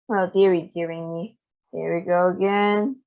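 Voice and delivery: plain reading voice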